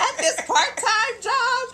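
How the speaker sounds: high, loud, slow laughing quote; laughing disbelief. Retold or imitated. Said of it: "'cause I'm like".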